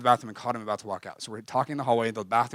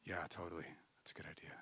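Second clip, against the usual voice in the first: soft brush-off; 6 semitones lower, 17 dB quieter than usual. Retold or imitated. Friend's brush-off, quoted softly.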